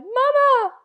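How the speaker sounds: very high, loud scream for mother; terrified cry. A narrator's reading. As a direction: scream for help in terror.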